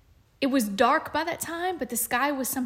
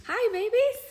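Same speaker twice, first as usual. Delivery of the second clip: put-on high cutesy baby voice; 11 semitones higher, 4 dB louder than usual. Podcast greeting in cutesy voice.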